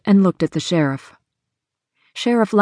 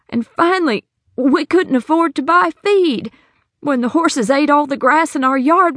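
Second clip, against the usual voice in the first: put-on raised, anxious older woman telling her troubles; Hattie's worried voice; 7 semitones higher than usual. Hattie explains to the sheriff how she lost money, anxious. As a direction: plead your story to the sheriff.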